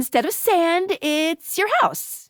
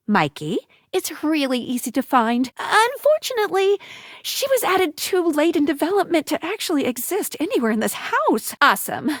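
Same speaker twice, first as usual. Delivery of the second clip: ordinary talk, nothing marked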